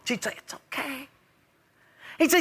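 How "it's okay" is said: soft, high, soothing murmur; calm reassurance. Acted. Wife answers husband's confession, calm reassurance. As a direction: hush it gently like the unruffled wife. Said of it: "you'd stay so calm".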